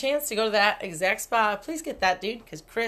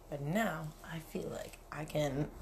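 whispered; 3 semitones lower, 11 dB quieter, slower than usual. Acted. Joking about pull-ups after massage.

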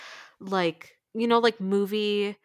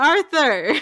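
loud, exasperated call of a name; exasperated shout; 7 semitones higher, 8 dB louder than usual. Real, not acted. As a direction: call him out in disbelief.

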